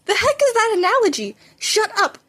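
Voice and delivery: high, indignant snap; incredulous outburst. Acted. Boys bickering over a silly analogy, indignant. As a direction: react in disbelief.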